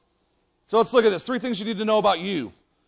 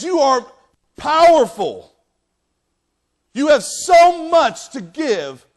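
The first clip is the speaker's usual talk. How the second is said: high, loud, stretched-out affirmation; emphatic affirmation; 6 semitones higher, 7 dB louder, much slower than usual. Acted. God viewing us as mighty, affirming loudly. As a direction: proclaim their worth with rising force.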